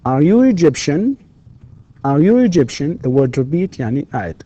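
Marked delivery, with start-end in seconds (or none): 0.0-3.0 higher pitch, upward tune through "Egyptian"; rising intonation. Shown as a demonstration. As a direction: clear drill question, rising on "Egyptian". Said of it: "rising intonation".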